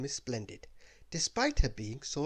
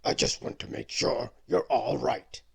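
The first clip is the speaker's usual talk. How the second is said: kindly beast; 6 dB louder than usual. Acted. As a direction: reassure her as a big beast. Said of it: "said the beast kindly".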